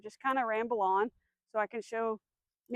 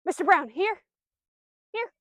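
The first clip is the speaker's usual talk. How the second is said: higher, louder call; calling the dog; 7 semitones higher, 6 dB louder than usual. Shown as a demonstration. Trainer calling her dog to come, higher and louder. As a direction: call him to you.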